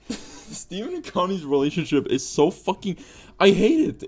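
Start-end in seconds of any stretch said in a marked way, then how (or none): none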